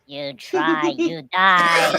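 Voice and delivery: menacing robot voice; Dalek threat. Retold or imitated. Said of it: "Dalek's like".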